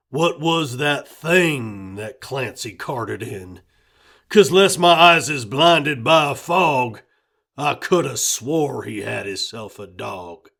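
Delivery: Red, gruff countryman. A narrator's reading. Wary father asking about the dog, loud folksy voice.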